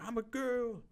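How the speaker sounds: high-pitched mock girl voice. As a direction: squeal it lightly like a put-on girl. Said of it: "She's like".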